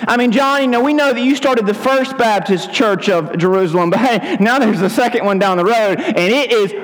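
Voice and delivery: high, goading busybody voice; rubbing it in. Acted. Townspeople nudge discouraged John toward quitting, high-pitched goading. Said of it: "I know how to talk like this".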